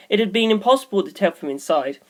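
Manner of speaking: plain reading voice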